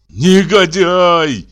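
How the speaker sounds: loud, high, long drawn-out insult; enraged insult. A narrator's reading. Enraged brother addressing his brother, furious insult. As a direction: stretch the word out in fury. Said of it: "сказал он, обращаясь к брату".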